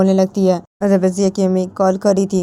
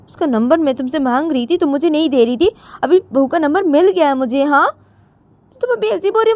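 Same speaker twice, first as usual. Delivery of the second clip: mother defends calling the daughter-in-law, raised; voiced mother arguing; 9 semitones higher than usual. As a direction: argue back high and stubborn.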